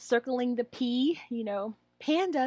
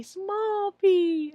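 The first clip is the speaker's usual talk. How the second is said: put-on high child's answer; student answer; 9 semitones higher, 4 dB louder than usual. Shown as a demonstration. Trainer voices the student naming small p, higher. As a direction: say it brightly like a pupil. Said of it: "they should say".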